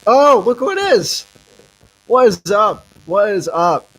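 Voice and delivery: excited greeting. Real, not acted. Greeting guest joining stream.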